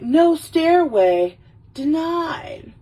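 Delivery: high, drawn-out movie quote; high-pitched mock indignation; mock outrage. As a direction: call it out high, stretching the first words. Acts out Wayne reading the sign.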